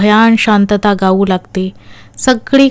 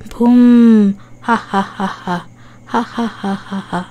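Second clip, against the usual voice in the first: ghostly cackle; slower than usual. Acted. Ghost gloats over the trick, cackling then fading. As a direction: cackle like a gleeful spirit, dying away. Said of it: "जोरजोरात ओरडत असते".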